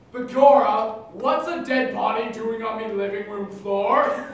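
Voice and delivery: hammed-up stage Irish brogue; loud jokey Irish accent. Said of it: "these two Irishmen".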